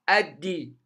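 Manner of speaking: "الدي" as ad-di, lam silent; doubled dal with i. Shown as a demonstration. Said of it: "في المنطقة الزرقاء،". (نطق "الدي" بدال مشددة مكسورة)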